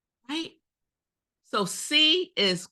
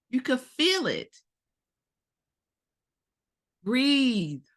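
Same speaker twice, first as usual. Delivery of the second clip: ordinary talk, nothing marked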